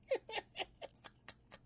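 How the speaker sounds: high, loud gleeful cackle; evil cackle. Acted. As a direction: cackle with wicked delight. GM cackles as character.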